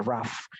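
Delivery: "rough" said raf with a short a; short vowel a in rough. Shown as a demonstration. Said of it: "Coba A yang pendek".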